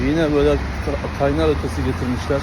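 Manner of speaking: talking with a scalded tongue; words careful around a burned tongue. Real, not acted. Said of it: "Ya dilimi yaktım".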